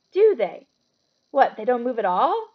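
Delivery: amazed. Acted. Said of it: "Ron sounded amazed".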